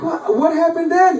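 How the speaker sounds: high, urgent retold question; acted-out incredulous prodding; agitated questioning. Acting out his agitated questioning of friend.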